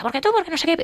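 high, heated quarrelling question; mock row. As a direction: fire it back mid-fight. Acted quarrel in the car. (discusión acalorada, aguda)

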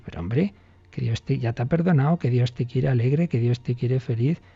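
ordinary talk, nothing marked

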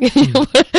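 loud, high laugh at her own retort; bright laughing burst after the joke; burst of laughter. Real, not acted. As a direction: laugh out at the punchline.

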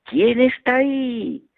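slow high echo of the call; the echo returning the child's call; echo reply. Retold or imitated. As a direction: answer back like a mountain echo. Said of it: "recibí una respuesta".

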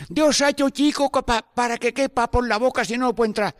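a hint of a simple old villager; old villager quoted. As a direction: priest imitating an old parishioner's words. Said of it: "Me dijo un anciano".

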